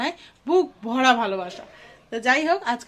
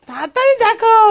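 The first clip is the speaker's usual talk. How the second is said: তাড়াতাড়ি called out higher and louder; excited urging; 10 semitones higher, 9 dB louder than usual. Real, not acted. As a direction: hurry them on with joy. Urging child to see gifts. (উত্তেজিত হয়ে জোরে "তাড়াতাড়ি দেখো")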